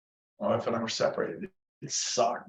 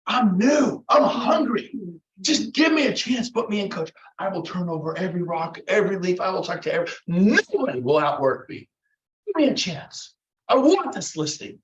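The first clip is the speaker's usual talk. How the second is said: higher, impassioned, punchy plea; hungry eager pitch; 5 semitones higher, 3 dB louder than usual. Shown as a demonstration. New agent begs a seller for the listing, fired up. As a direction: pour urgent hustle into every promise.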